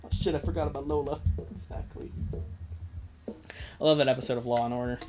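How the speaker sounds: normal talking voice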